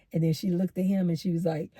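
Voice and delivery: usual speaking voice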